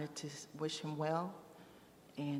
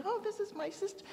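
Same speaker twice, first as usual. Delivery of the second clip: much higher, beaming exclamation; delighted; faster than usual. Retold or imitated. Brother introducing his newfound sister, delighted and high. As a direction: sound thrilled to show off your sister.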